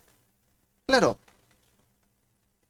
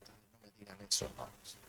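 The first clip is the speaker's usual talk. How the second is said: hushed aside; 7 semitones lower, 22 dB quieter than usual. Real, not acted. Preacher aside to congregation.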